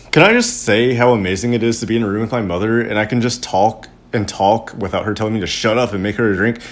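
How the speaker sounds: usual speaking voice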